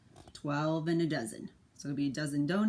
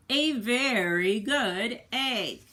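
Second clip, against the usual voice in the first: slow, drawn-out, proud emphasis on very good egg; 7 semitones higher, 8 dB louder, much slower than usual. A narrator's reading. Parent reading to a child, slow proud emphasis. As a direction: stretch every word of A very good egg. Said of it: "emphatic book line".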